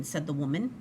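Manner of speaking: usual speaking voice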